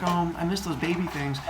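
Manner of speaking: soft, mildly wistful pining; mock sad mother, hushed; a little sad. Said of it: "a little on the sad side".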